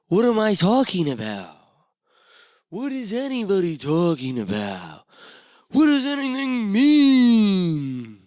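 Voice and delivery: rising, then a long, slow, loud and high wail; mock existential despair. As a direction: ham up the big cosmic question.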